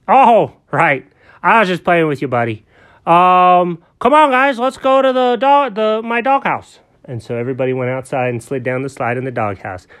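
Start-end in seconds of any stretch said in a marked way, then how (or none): 0.1-6.8 higher, louder friendly grown-up character; Alfred's kindly voice. Acted. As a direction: talk brightly to a little buddy.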